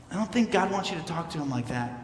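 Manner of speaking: mild, hushed reproof; quiet disapproval. Acted. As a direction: say it under the level of the sermon. Voicing offended Christians' quiet disapproval.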